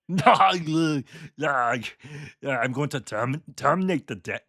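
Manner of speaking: bad Austrian movie-star accent; hammy Austrian action hero impression; Arnold Schwarzenegger impression. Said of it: "terrible impression of Arnold Schwarzenegger".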